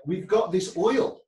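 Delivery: slow, loud, rough attempt at Russian accent. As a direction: imitate a Russian pitching business, slowly. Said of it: "I'm very bad at accents".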